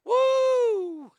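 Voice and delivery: high, loud wrestler woo; wrestling woo. Acted. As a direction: let out a big pro wrestling woo. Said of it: "like old school wrestling".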